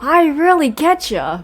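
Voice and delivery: hammed-up empathy; self-styled empath impression. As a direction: play an overconfident sympathizer. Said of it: "Ippei's like".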